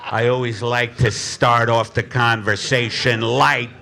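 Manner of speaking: low, slow hard-boiled narration; noir story narration. Acted. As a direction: brood over the rain like a noir detective.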